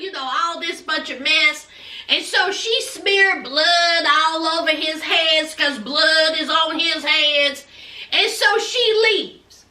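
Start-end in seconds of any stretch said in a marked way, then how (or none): none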